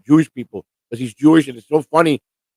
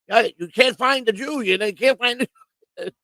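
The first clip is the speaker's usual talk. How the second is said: high-pitched Shirley voice; 9 semitones higher than usual. Retold or imitated. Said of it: "And Shirley's like".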